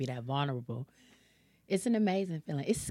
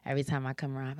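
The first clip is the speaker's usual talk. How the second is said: ordinary talk, nothing marked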